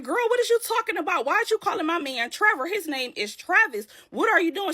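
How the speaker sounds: high, fast mock quarrel; indignant arguing. Amber defends her man to Tiffany, indignant.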